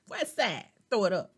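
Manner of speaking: excited party hype; hyped shout-out. Real, not acted. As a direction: shout it out to the crew.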